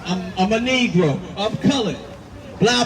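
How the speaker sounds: ordinary delivery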